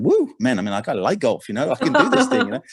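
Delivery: excited, pitch shooting way up; pumped up. Retold or imitated. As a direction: relive the thrill of the perfect drive. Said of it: "I'm getting all pumped up".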